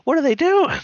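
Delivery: high-pitched, loud, exasperated disbelief; upset, mad outcry. Retold or imitated. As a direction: cry out like an outraged fan. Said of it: "I was mad when it happened".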